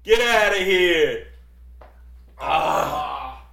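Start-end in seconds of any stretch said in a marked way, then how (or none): 0.0-1.3 drawn-out high loud get outta here; slow, loud mock brush-off, stretching every word. Real, not acted. Dismissing a friend's joke, slow loud teasing.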